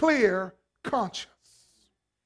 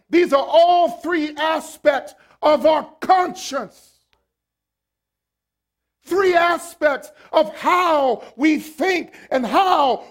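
loud, slow, higher preaching shout; full-force preaching; 5 semitones higher, 6 dB louder, slower than usual. Acted. Preacher to congregation, a loud, slow, rising preaching shout. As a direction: preach it out, rising and hammering each phrase.

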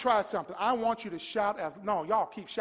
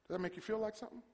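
ordinary talk, nothing marked

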